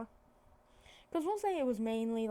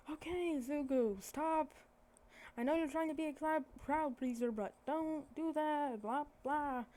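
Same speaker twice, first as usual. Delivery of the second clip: chiding; 5 semitones higher than usual. Retold or imitated. Acted dad chiding his son.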